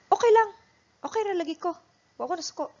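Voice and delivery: high, loud, curt "Okay lang", fading as it goes; sulky, not okay. Shown as a demonstration. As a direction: say you're fine while clearly upset. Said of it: "answer it in that way".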